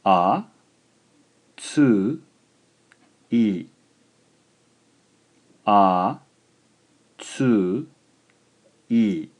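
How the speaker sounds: syllable by syllable. Shown as a demonstration. Teacher dictates a three-syllable word: slow syllables. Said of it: "three syllables long".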